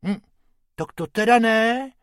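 high-pitched objection; protesting. Acted. Bobek objecting to the advert, protesting. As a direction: refuse indignantly. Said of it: "protestoval Bobek".

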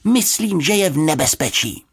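put-on high, alarmed girl's call; urgent high-pitched warning; shout over shoulder. A narrator's reading. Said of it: "křikla Juditka přes rameno".

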